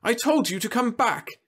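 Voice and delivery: high, loud mother's scolding; cross, high-pitched telling-off; snapped. A narrator's reading. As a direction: snap at a disobedient child. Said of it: "she snapped at the little girl".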